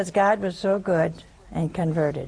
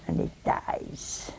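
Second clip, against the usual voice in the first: whispered ending; 10 dB quieter, slower than usual. Real, not acted. Dramatic end of the story.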